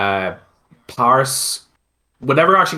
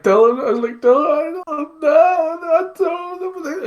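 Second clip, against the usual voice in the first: very high, cracking cry voice; sobbing falsetto. Retold or imitated. Groom crying to his brother at the altar, high broken wail. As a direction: wail his name through mock tears.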